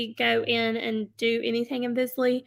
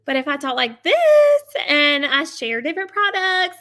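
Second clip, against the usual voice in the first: louder, lively, up and down; lively varied voice; 2 semitones higher, 7 dB louder than usual. Shown as a demonstration. Seller shows live viewers a lively voice. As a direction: bring energy so viewers stay. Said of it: "fluctuate your voice".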